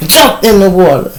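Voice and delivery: ordinary delivery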